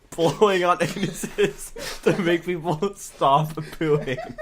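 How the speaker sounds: slow, loud words through laughter; laughing. Real, not acted. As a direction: drag it out while laughing. Cracks up mid sentence.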